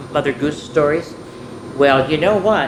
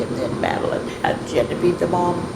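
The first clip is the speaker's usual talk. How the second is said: usual speaking voice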